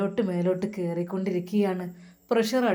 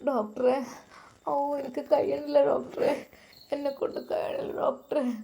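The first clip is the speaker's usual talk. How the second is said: crying; 5 semitones higher, 3 dB quieter than usual. A narrator's reading. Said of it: "lab റൂമിൽ നിന്ന് കരയുകയാണ്".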